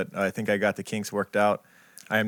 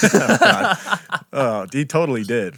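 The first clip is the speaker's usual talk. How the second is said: laughing; 5 semitones higher, 8 dB louder, slower than usual. Real, not acted. Laughing at his own joke.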